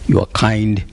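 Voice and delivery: usual speaking voice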